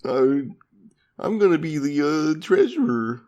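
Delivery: high, put-on rich-kid voice; spoiled mayor's son. Mayor's son announces he'll be treasurer, spoiled high voice. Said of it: "spoiled son of the mayor".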